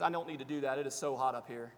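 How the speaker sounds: ordinary talk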